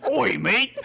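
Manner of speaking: a cheesy fake Cockney greeting, laughing. Asked for his most British accent, a mock Cockney accent.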